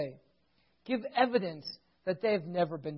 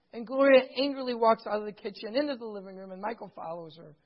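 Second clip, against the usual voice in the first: usual speaking voice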